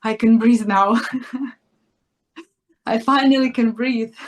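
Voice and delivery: relieved, breaking into laughter; finally free to breathe, laughing it out; acted relief. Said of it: "feels really alive and finally feels like".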